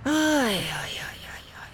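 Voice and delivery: whispered sighing lament. A narrator's reading. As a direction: breathe out the woes, barely voiced. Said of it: "sóhajtott Gombóc Artúr". (suttogó sóhajtozás)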